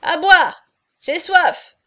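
high, loud call for drink; shouted demand. A narrator's reading. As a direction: shout for wine across the room. Hunchback calls for drink.

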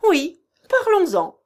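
high, booming interjection; sudden loud cry from the doorway; loud, strong voice. A narrator's reading. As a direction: call out forcefully to startle everyone. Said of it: "s'écria une voix forte".

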